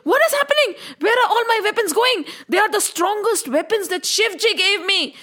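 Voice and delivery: high, fast panicked disbelief; bewildered villain. Acted. Vishwarath watches his weapons vanish, high panicked disbelief. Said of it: "Vishwarath is saying".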